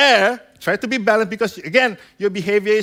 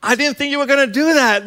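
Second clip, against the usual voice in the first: higher-pitched put-on astonishment; feigned surprise; 5 semitones higher, 3 dB louder than usual. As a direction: act surprised for the child's sake. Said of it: "act surprised".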